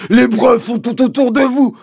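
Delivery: screaming himself hoarse; Mister Hazel bawling. A narrator's reading. Landowner pointing out evidence to the sergeant, high bawling. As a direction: yell at the top of the voice, high. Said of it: "s'écosillait mister Hazel".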